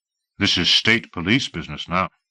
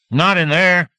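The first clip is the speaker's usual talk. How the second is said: sharp rebuff; 9 semitones higher, 5 dB louder than usual. A narrator's reading. Deputy stops grabbing.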